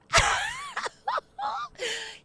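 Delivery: high shrieking laugh. Real, not acted. Host shrieking with laughter at a reader's compliment. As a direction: let out a delighted scream of laughter.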